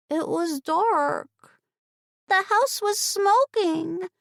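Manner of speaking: put-on high, slow child's voice; little girl. A narrator's reading. Ember explaining to her aunt, high slow child voice. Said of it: "Ember said".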